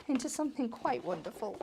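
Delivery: plummy upper-class lilt; posh voice. Pretend. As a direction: say it like a grand lady. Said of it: "was that your posh voice".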